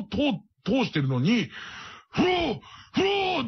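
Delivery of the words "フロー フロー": high, slow drawn-out creature call; beluga call. Retold or imitated. Beluga calling through the aquarium glass, drawn-out high creature cry. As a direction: imitate a whale's eerie cry through glass. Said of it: "って聞こえる声で".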